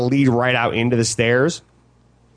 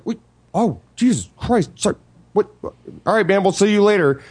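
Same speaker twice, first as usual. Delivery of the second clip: lightly voiced flustered couple, a bit higher; hurried awkward apology, pitched up; 5 semitones higher than usual.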